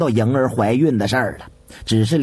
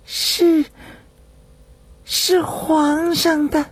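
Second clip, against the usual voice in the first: bashful maid, much higher and slow; shy murmur; 13 semitones higher, much slower than usual. A narrator's reading. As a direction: murmur it coyly, embarrassed. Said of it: "扭捏了半天才喃喃说道". (羞涩地喃喃低语)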